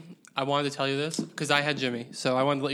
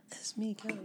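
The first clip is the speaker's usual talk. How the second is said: tiny microphone voice; 6 semitones higher, 11 dB quieter than usual. Retold or imitated. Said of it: "So if you hear a".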